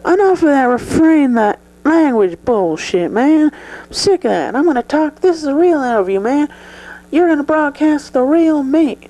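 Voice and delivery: Joe pushes back, insists on speaking freely; defiant Joe. Acted. To interviewer, Joe defiantly demands to be real.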